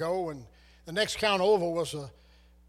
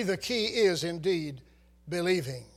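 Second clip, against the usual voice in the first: ordinary talk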